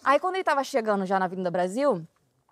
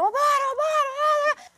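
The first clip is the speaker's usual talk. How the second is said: urgent calling out. Retold or imitated. Policeman bangs on the window yelling to move on, high urgent shout. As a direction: hurry them along with a shout.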